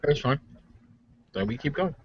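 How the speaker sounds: usual speaking voice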